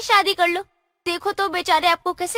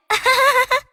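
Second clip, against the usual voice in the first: giggle; 7 semitones higher, 4 dB louder than usual. Acted. Fairy laughs at own joke.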